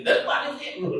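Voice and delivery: high-pitched wifely telling-off; mock scolding. Wife tells off her returning husband, high mock scolding. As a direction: imitate a nagging wife, voice pitched right up.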